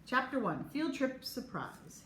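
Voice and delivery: ordinary delivery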